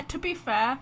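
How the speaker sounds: normal talking voice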